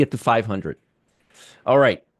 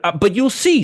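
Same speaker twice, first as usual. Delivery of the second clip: ordinary talk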